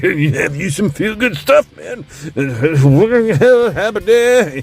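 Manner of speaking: mock an incoherent drunk singer, laughing. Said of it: "He sounded just as incoherent".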